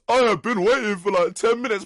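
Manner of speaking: very loud, high-pitched mock yell; shouting customer. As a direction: holler an impatient complaint across the bar. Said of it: "is shouting at me already".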